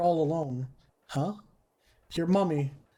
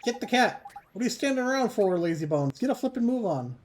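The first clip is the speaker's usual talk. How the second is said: higher, pushy cheeky sidekick barking orders; bossy fairy; 7 semitones higher than usual. Acted. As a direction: hurry him along like an impatient little fairy. Fairy bosses the boy around.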